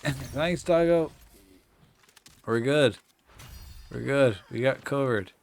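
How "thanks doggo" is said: ordinary delivery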